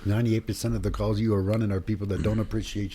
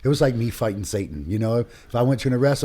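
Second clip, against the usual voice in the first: ordinary talk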